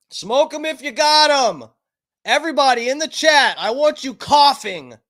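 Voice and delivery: high, loud call to the whole chat; rallying shout. Real, not acted. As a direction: holler it out like a rallying cry. Calling out to the chat.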